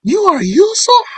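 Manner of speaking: very high, incredulous questions; mind-blown. Retold or imitated. Brothers asking if it is Yusuf, astonished. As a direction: let the pitch leap up in disbelief. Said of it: "These brothers are mind blown here".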